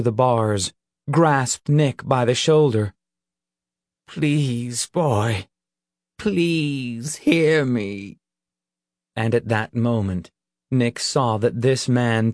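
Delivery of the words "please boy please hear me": slow, tender begging; near-tearful plea. A narrator's reading. Reverend grasps Nick's shoulder, pleading near tears. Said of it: "the man was near tears".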